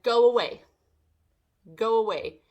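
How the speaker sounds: retold firm command. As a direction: send them off loudly and firmly. Telling unwanted visitors to leave.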